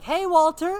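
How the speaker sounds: high, loud mock call out a window; mom calling out. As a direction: holler a cheery greeting as someone's mother.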